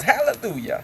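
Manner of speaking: high-pitched revival outburst; mock churchgoer's high cry; yelled hallelujah. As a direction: burst out in religious fervor. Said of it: "she jumps up and yells".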